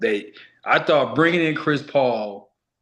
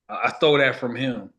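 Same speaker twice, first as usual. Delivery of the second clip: normal talking voice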